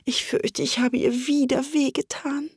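high, subdued regret; remorseful. A narrator's reading. Alice fears she hurt the mouse, small and remorseful. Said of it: "in reumütigem Tone".

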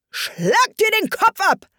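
high, slow, shrill royal command; shrieking queen. Acted. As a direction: shriek the order down from the throne. Queen orders beheading.

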